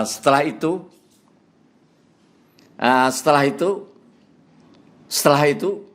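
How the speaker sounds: halting, repeating "setelah itu" with rising ends; mimicking a repetitive speaker. Shown as a demonstration. Lecturer demonstrates a monotonous preacher, halting mock repetition. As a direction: show a preacher stuck on the same connector.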